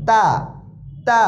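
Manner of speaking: তা light and thin, tongue tip at the teeth; touch the tongue tip to the upper front teeth for a light তা. Shown as a demonstration. Teacher separating two confused letters, thin light তা. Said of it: "পাতলা হবে".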